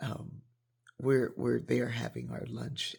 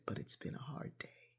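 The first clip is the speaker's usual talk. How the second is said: whispered admission; 2 semitones higher, 10 dB quieter than usual. Retold or imitated. Weary man's whispered admission.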